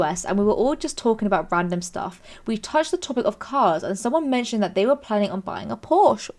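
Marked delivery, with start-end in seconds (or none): none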